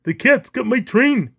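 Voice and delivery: high, loud cartoon wail; alarmed engineer. A narrator's reading. Engineer whose train the cat grabbed, panicked cry. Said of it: "cried the engineer".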